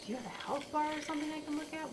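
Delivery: ordinary talk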